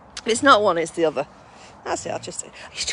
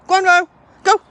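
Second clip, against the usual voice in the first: calling out; 11 semitones higher, 11 dB louder than usual. Real, not acted. Urging the dog on.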